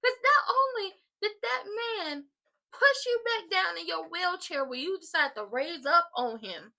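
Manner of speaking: loud, high, slow, emphatic; worked up. Real, not acted. Vlogger retelling the wheelchair attack, loud high outrage. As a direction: pile on the outrage.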